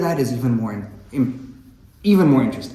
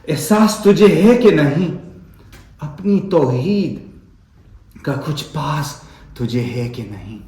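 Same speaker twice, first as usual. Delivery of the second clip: louder, declaiming the verse with feeling; Urdu poem recitation; 4 semitones higher, 5 dB louder than usual. Acted. Reciting Iqbal's Shikwa to the audience, as a fervent poem. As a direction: recite the couplets like a mourning plea.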